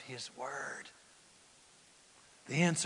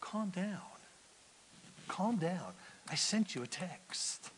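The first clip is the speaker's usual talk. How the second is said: hushed and gentle, much softer; calm, soft; 11 dB quieter than usual. Acted. God calming a furious Naaman, soft and gentle. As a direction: soothe him quietly, almost a murmur. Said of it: "And God says".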